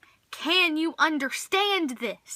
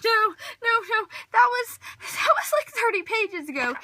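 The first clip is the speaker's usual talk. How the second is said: much higher, louder whiny wail; mock despair; 8 semitones higher, 3 dB louder than usual. Reader flipping pages, whining in mock despair. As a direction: ham up a distraught near-sob. Page search going wrong.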